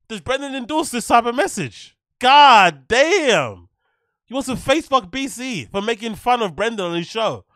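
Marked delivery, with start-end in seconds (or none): 2.2-3.7 loud shocked. Real, not acted. Shocked reaction.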